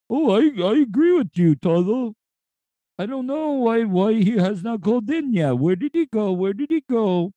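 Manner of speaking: high cartoon vulture voice. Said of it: "the impersonation".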